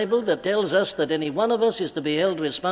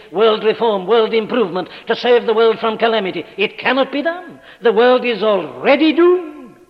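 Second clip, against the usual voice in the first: loud, high pulpit appeal; impassioned preaching; 5 semitones higher, 6 dB louder than usual. Acted. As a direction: plead with the congregation to flee. Preaching at full force.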